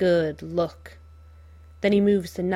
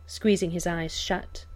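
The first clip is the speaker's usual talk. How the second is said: ordinary delivery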